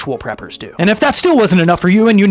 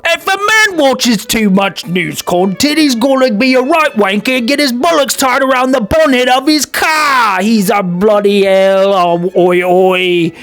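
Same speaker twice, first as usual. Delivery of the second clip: slow, loud, higher Cockney rant; rowdy English accent; 5 semitones higher, 5 dB louder, slower than usual. Pretend. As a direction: play a drunk English lout shouting. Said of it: "we have a quote".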